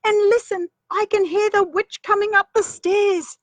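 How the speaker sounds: put-on high, eager girl's voice; a small girl pointing something out; high excited child voice; little girl voice. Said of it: "said Rose".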